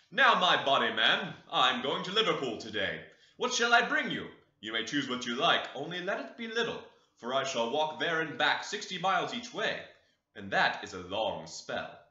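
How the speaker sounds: put-on loud, hearty old farmer speaking to his boy; fond father announcing his trip, loud and cheerful; old master's voice. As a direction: call out proudly to your son before a long journey.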